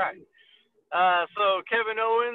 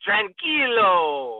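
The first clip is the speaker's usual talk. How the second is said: very slow, drawn-out catchphrase; Andrade; much slower than usual. Acted. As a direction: stretch the word out like a wrestler's catchphrase.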